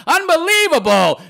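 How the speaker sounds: high, loud, laughing exclamation; scornful laugh carried into the word; laughing disbelief. Real, not acted. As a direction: burst out incredulous through laughter. Laughing at commenter's contradiction.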